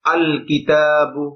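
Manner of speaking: আল কিতাবু drawn out slowly, the lam of আল clearly sounded; deliberate teacher demo of আল কিতাবু with a distinct lam; slow pronunciation demo. Shown as a demonstration. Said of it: "আলিফ লাম প্রয়োগ করে দেখেন".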